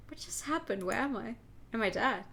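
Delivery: usual speaking voice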